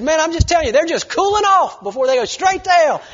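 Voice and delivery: loud, high insistence; yelling. Retold or imitated. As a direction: keep hammering it home loudly. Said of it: "he yells it really loud".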